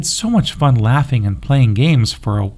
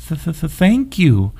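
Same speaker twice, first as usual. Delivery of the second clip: slow, stuttering timid bunny voice, higher; hesitant stammer; 6 semitones higher, much slower than usual. A narrator's reading. Nervous bunny thanking the puppy, slow timid stammer. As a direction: stammer the thanks, too shy to get it out. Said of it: "whispered hesitantly".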